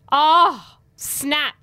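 loud, high "oh", then a punchy finish; gleeful mock shock. Host reacting to Tracy's retold comeback, gleeful mock shock. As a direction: crow at a savage comeback.

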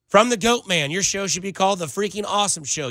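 normal talking voice

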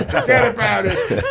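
high, laughing Brooklyn "forget about it"; hammed-up New York wiseguy line; playing his undercover Brooklyn character, laughing; Brooklyn tough guy. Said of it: "Joey is like".

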